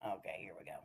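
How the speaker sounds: breathy hush before the clip plays; soft whisper, getting ready; whispered to herself. Real, not acted. To her viewers while starting the clip, a whisper.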